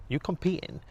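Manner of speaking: mild mock of a curious gym-goer; curious gym guy. Gym people asking, high quote.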